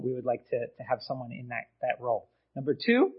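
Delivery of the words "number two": sudden upward voice crack. Real, not acted. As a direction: voice cracks up high on the word. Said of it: "don't know why my voice just cracked".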